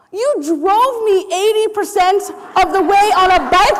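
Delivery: high-pitched mock outrage; hammed-up incredulous objection; indignant protest. Acted indignant protest to driver.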